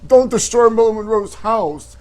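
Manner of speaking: pleading prank call as John Travolta; hammed-up Travolta begging. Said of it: "phone call from John Travolta".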